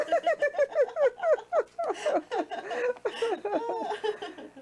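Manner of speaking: high, loud giggle; embarrassed laugh. Real, not acted. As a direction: laugh it off shyly. Embarrassed after compliment.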